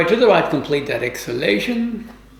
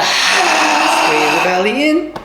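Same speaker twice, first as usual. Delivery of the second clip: drawn-out shout; 8 semitones higher, much slower than usual. Shown as a demonstration. Teacher urging the class on the last squeeze, slow shout. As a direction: call it out hard to push the class.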